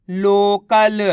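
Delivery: लोकल drawn out syllable by syllable; blended reading. Shown as a demonstration. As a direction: join the sounds slowly into one long लोकल. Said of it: "तो मिलके बन जाएगा". (लोकल को धीरे, खींचकर पढ़ते हुए)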